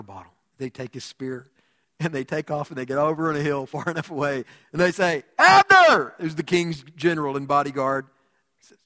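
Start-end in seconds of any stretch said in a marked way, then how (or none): 5.4-6.1 high, loud, drawn-out holler; calling out. Acted. David calling the king's bodyguard from a far hill, hollering. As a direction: shout the name across a valley.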